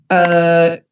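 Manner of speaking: low, understated imitation of a shrug; imitated offhand answer. Actor answering interviewers, imitated offhand and low. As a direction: drop low for a mock-casual reply. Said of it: "people interview him, be like".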